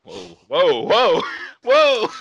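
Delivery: mock-shocked whoa whoa; mock alarm.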